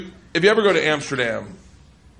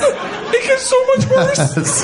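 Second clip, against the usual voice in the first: slow, higher, laughing through the words; much slower than usual. Real, not acted. Comic warning his host the story worsens, laughing. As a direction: barely get it out for laughing.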